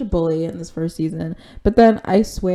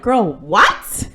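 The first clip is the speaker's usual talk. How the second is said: retold incredulous question, rising at the end; mock disbelief; 2 semitones higher, 4 dB louder than usual. Vula reacts to not being thanked, mock disbelief. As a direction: play the snubbed friend's disbelief.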